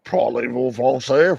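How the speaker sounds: slow, hammed-up bad French accent. Tries a French accent for the chat, badly. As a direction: try a French accent and miss. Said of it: "I can't do a good French accent".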